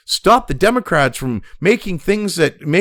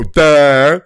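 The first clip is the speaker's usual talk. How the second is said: mock dumb duh; 5 semitones lower, 6 dB louder than usual. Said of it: "to go".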